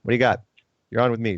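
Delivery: ordinary delivery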